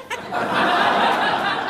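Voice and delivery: high, loud unvoiced burst of laughter; high shrieking laugh. Real, not acted.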